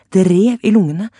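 usual speaking voice

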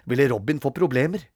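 ordinary delivery

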